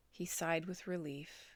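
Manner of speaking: ordinary delivery, nothing marked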